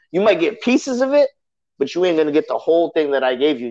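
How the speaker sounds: usual speaking voice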